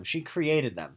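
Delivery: normal talking voice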